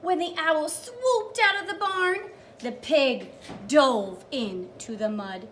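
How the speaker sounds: put-on a teacher reading the page to young children; storytime reading. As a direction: read it big for little listeners. Reads storybook aloud to kids.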